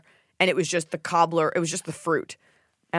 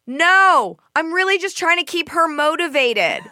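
higher, louder mock-chirpy trainer; mock the trainer's perky refusal; imitating the trainer; 11 semitones higher, 6 dB louder, slower than usual. Trainer refusing to turn music down, mock perky. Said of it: "she goes".

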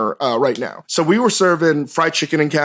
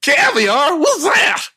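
high, exaggerated puzzled Southern voice; mock Southern guest; 10 semitones higher, much slower than usual. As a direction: ham up the clueless guest. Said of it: "is that your accent or is that their accent".

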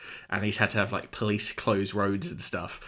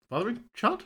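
double take; 12 semitones higher, 4 dB quieter than usual. Real, not acted. Catches own wrong word.

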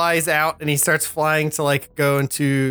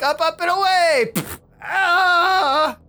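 heroic cry; slower than usual. Retold or imitated. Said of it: "He's like".

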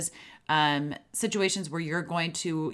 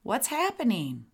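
curious calm question; 7 semitones higher than usual. Shown as a demonstration. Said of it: "You can say to them".

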